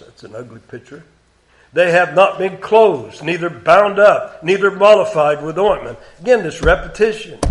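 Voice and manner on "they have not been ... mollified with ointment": raised, deliberate King James reading; solemn scripture reading. Acted. Preacher reading God's words to congregation, raised. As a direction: read it as God's complaint against Israel.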